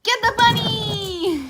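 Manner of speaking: loud, slow laughing call; laughing cheer. Real, not acted. Owner laughing while urging the dog on.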